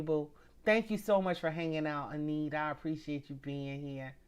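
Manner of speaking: normal talking voice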